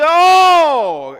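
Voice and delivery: a big, high cry of longing; loud exclamation. Acted. Preacher voicing the psalmist's exclamation, a loud high cry. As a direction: belt out the Oh like a cry to God. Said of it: "con signo de exclamación dice".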